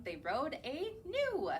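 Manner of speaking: ordinary delivery, nothing marked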